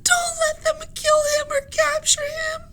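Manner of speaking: very high, very loud crying plea; sobbing plea. Acted. Annie sobs to Henry for help, very high and loud. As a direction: sob for help. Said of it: "she sobbed".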